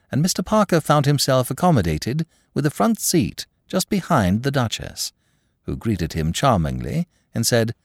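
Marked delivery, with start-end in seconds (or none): none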